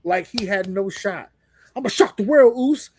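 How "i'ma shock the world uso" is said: higher, louder mock Jey boast; mock wrestler boast; imitating Jey's promo. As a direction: lightly ham up his hype line. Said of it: "he did this whole promo".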